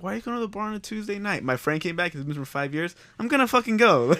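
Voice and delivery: high, quick mock wife, then the friend's answer; playing the wife who questions the Tuesday bar trip; mock nagging wife. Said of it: "your wife would be like".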